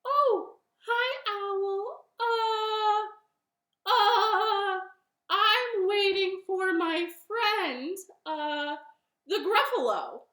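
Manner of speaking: high, loud, hesitant bunny voice; nervous bunny. Acted. Bunny makes excuses to owl, high and hesitant. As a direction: stall in a high little voice. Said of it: "And Bunny said".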